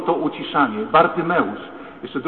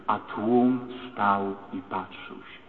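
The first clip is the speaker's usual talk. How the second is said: slow solemn quote; 4 semitones lower, 4 dB quieter, much slower than usual. Acted. Quoting the Gospel solemnly.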